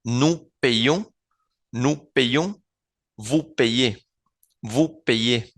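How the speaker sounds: "payons", "payez" as "pe-yón", "pe-yé"; Y como vocal. Shown as a demonstration. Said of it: "recordando que es una vocal". ("payons" y "payez" con la Y como vocal)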